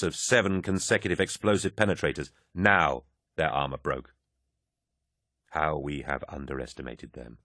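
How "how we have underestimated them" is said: low, hushed thinking to oneself; inner thought. A narrator's reading. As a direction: think it privately, softer and lower. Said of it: "Loken thought".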